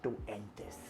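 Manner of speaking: low, soft, menacing action-hero voice; hushed, deep film-hero threat; brooding hero. Retold or imitated. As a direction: growl it low like a movie tagline. Hero's dramatic line, acted quote.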